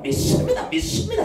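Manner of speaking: high, strained mock profession of faith; mock fervent belief. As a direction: parody a zealous believer. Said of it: "그냥 목소리로 믿는 게 아닙니다".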